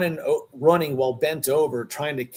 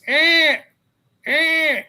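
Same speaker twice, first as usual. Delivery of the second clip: two high, strained cat mewls; squealing cat sounds, one after another; imitated cat cry; 7 dB louder than usual. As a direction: cry out twice like a distressed cat. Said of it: "And I'm hearing this".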